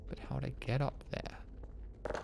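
creaky, quiet vocal fry; vocal fry endings. Real, not acted. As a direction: let the voice crackle into fry. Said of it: "My vocal fry is nuts".

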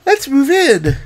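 high, put-on woman's voice; her character voice. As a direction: pitch it up like a cheery suggestion. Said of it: "I was trying to do her voice, like, a, a certain way".

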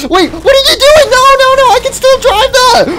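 high, loud frantic begging; panicked pleading. Acted. Car owner begging the rider not to break it, frantic. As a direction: beg him to stop, panicking.

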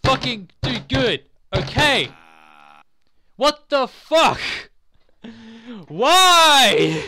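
loud, high gamer outburst; real frustrated shouting at a game; frustrated yelling. Real, not acted. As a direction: yell in exasperation, pitch climbing.

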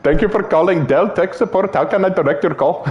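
jokey Indian-accented call centre greeting; put-on Indian accent. Shown as a demonstration. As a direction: play a tech support agent with an Indian accent. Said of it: "How about an Indian accent?".

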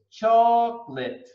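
chocolate split in two, CHOC-lit; two syllables. Shown as a demonstration. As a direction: model "chocolate" in just two beats. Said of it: "two syllables".

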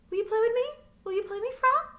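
high, loud hopeful duckling voice; eager duckling. Acted. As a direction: beg the frog to play. Ducky asks frog to play.

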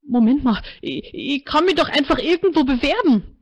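grasping for a way out; anxious protest. A narrator's reading.

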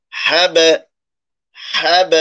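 হ্যাব with the b bounced out like an echo; qalqala demo. Shown as a demonstration. Teacher shows students the echoing b. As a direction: pop the final b of হ্যাব. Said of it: "এখানে কলকলা হবে".

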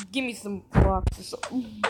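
ordinary delivery

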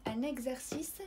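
ان اكزرسيس with x as voiced gz; French ان اكزرسيس, gz clearly voiced; gz sound. Shown as a demonstration. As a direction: say ان اكزرسيس as "an egzersis". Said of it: "كنسمعوا كز".

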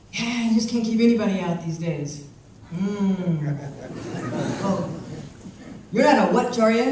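a hint of a wary old man, loud; lightly voiced old man.

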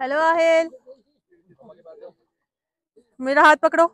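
usual speaking voice

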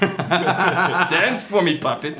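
drawn-out mock villain line with a laugh; slow laughing villain. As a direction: gloat it slowly like a puppet master. Voicing the mooching guest.

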